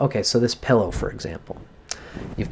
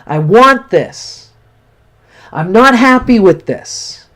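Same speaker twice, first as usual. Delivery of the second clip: much higher and louder, venting frustration; demo angry outburst; 10 semitones higher, 6 dB louder, slower than usual. Shown as a demonstration. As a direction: vent at the pillow like a frustrated child. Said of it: "you can cry and you can just say".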